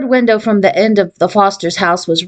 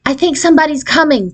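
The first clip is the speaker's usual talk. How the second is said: warning; 6 semitones higher than usual. A narrator's reading. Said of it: "Patty warned".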